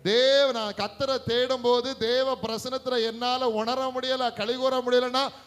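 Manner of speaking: loud, high, fervent exhortation; full-force preaching. Real, not acted. Preacher urging believers to weep for God's presence, full-force preaching. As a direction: drive it home at full volume.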